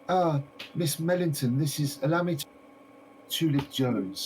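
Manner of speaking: a lightly voiced gentleman introducing a guest; a touch of a courteous old-fashioned host; polite gentleman. Mr Winter introducing Tulip to the shopkeeper, lightly voiced.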